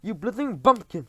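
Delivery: high, loud furious insult; screeched. Acted. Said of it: "screeched the Grand High Witch".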